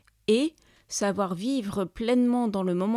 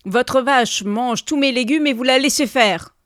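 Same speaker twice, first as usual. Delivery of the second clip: very loud, higher accusation; indignant shouting; 4 semitones higher, 10 dB louder than usual. A narrator's reading. Neighbour blames husband for eaten vegetables, indignant shouting. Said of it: "cria le voisin".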